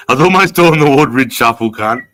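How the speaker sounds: loud, high, laughing outburst. Real, not acted. Man joking about the Woodridge shuffle, laughing loudly. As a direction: crack up at your own comparison.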